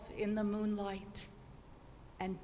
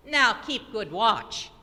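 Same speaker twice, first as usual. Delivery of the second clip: jeering soldier; 9 dB louder than usual. Acted. Soldiers mock the bound girl.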